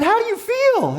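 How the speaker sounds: very high, loud rallying question; exhilarated high-pitched shout. Acted. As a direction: holler it to your fired-up friend. Coach shouting to fired-up friend.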